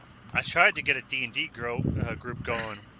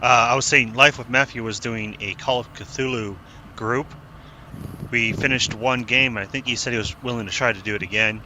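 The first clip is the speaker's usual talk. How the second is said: speaking up, louder and clearer; 5 dB louder than usual. Real, not acted. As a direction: push the voice out more. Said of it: "How about now?".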